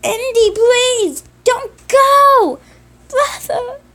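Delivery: high, loud crying plea, slow at first; desperate pleading. Acted. Simon begging his brother not to leave, loud and crying. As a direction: beg him not to go, voice cracking. Simon begs as Andy is taken.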